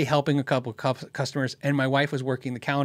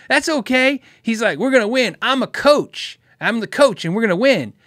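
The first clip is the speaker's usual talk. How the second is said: boastful coach; 5 semitones higher, 4 dB louder than usual. Retold or imitated. Loud customer boasting.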